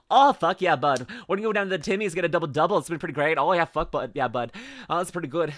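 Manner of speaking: broad Canadian buddy talk; hammed-up Canadian accent. Shown as a demonstration. Streamer mocking own speech, hammed-up Canadian accent. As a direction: lay the Canadian accent on thick. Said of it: "I sounded more Canadian than usual".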